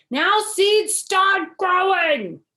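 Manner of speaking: high, loud, slow yell; loud, drawn-out high shout. A narrator's reading. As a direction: bellow it at the dirt.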